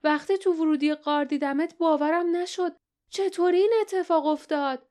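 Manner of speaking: put-on a girl thrilled to see a friend alive; happy girl's voice. A narrator's reading. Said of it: "با خوشحالی گفت".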